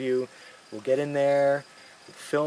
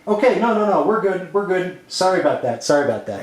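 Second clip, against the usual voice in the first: louder, higher hurried sorry; nervous director apologising, raised voice; flustered apology; 5 semitones higher, 6 dB louder than usual. Acted. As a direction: scramble to smooth it over. Flustered apology after interruption.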